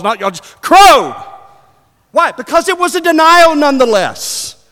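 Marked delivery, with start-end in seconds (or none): 0.6-2.3 high, very loud single-word shout; shouted prompt. Shown as a demonstration. Preacher showing the congregation their answer, shouted. 2.3-4.6 high, loud, shouted preaching; full-force preaching. Acted. Preacher on lions together and mice alone, full-force preaching.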